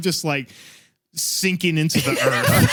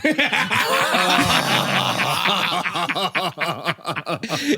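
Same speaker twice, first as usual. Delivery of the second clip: roaring laugh. Real, not acted. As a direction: lose it at the punchline. Roaring at punchline.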